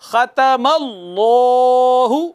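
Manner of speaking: ख़तमल्लाहु drawn out with a long stretched final; full slow recitation. Shown as a demonstration. Teacher reciting the complete word with all rules. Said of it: "तो देखिए".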